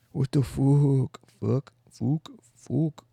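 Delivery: put-on drawn-out Irish-accented curse; bad Irish accent. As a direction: stretch the vowel in a botched Irish brogue. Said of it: "I can't do an Irish accent".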